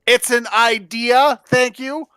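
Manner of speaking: loud defensive. Real, not acted. Defending himself to teasing players.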